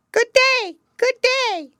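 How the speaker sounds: high, loud bird-like greeting; raven voice. Acted. Raven says hello to Gerda, high and loud. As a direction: squawk it out like a talking raven.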